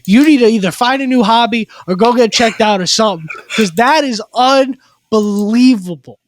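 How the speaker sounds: worked-up, emphatic rant; fired up. Real, not acted. Podcaster hyping listeners to run through a brick wall, fired up. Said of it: "almost needed a crash card for you today".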